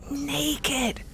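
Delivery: soft unvoiced aside; whispered. Real, not acted. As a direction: breathe the word cheekily. Whispered aside about actor.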